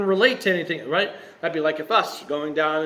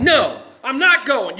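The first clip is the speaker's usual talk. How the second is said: mock childish defiance, pitched up; foot-stomping tantrum; 5 semitones higher, 4 dB louder than usual. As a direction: act out a stubborn refusal, stomping mad. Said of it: "stomping his feet and saying".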